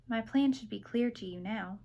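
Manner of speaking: ordinary delivery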